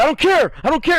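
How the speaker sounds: high, loud retold yell; mock angry yell. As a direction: bark it back at the teacher. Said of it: "And he goes".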